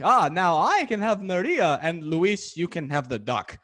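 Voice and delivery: loud, slow put-on tenor voice. Host voicing [name] claiming [name], gloating and grand. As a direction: gloat like a triumphant opera tenor. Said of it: "You do that very well".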